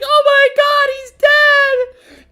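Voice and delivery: high shrieking melodrama, very loud; overdramatic mother. Retold or imitated. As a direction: overact a hysterical mother's cry. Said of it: "put up this whole show".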